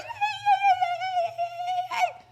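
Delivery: very high squealed greeting; excited greeting. Retold or imitated. Hugger walking up to friends, excited high squeal. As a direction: squeal it rapidly in a sky-high voice.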